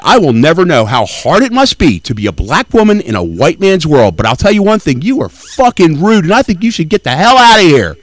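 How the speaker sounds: loud, angry, rising into a high yell; loud telling-off. Retold or imitated. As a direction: tell her off loudly, getting angrier.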